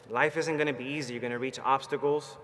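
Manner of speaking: ordinary talk, nothing marked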